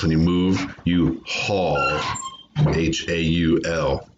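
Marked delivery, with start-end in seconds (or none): 2.7-4.1 slow letter-by-letter spelling; measured teacherly spelling; spelled out. Shown as a demonstration. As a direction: spell it slowly, one letter at a time.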